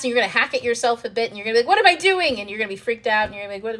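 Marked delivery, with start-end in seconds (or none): 1.7-2.4 high, loud panicked cry; panicking. Retold or imitated. You mid-panic, hacking at yourself, high loud cry. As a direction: blurt it out in sudden alarm. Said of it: "That's you panicking".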